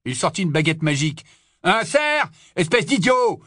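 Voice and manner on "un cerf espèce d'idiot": high, scornful roar. A narrator's reading. Barman pulling his wand on a doubter, roaring. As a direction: roar the insult at him. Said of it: "rugit le barman".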